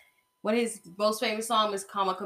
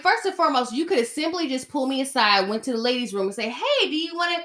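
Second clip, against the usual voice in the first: a mock polite scolding, pitched up; mock sassy lecture; 7 semitones higher, faster than usual. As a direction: put her in her place, sweetly fake. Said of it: "I'm like".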